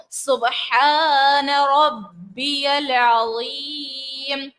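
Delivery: very slow, melodic tasbih; slow chanted tasbih; tajweed recitation. Shown as a demonstration. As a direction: recite with qalqala and stretched vowels. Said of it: "با حروف قلقلہ میں سے ہے".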